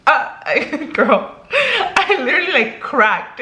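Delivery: cracking, high, half laughing half crying; laughing through tears. Retold or imitated. Telling viewers how she cried on the call, laughing. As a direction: voice breaking up in a laugh. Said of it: "I literally started crying".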